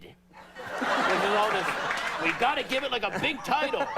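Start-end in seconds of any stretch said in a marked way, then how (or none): 2.2-3.9 lightly voiced producer pitching high; high-pitched bit of a pushy producer; movie producer. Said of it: "it's like some producer".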